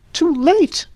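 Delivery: high shocked outcry. Acted. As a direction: cry out in disbelief. Shocked repetition.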